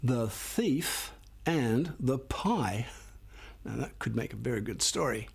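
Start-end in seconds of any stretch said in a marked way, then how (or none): 0.0-2.9 slow title. Shown as a demonstration. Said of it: "How about this one?".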